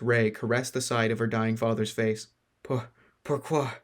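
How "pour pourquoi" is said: faint broken French plea, slow; weak, barely speaking. Acted. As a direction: gasp it out, dying. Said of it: "could barely speak".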